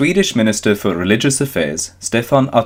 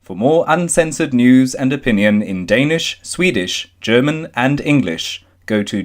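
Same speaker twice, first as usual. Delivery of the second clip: higher, bright announcer promo; promo read; 4 semitones higher than usual. Acted. Reading the publication promo to listeners, announcer read. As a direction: sell the publication like a station ID.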